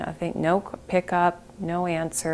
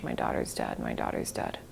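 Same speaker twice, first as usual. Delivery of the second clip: crying; 8 dB quieter than usual. Retold or imitated. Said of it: "I just kept crying".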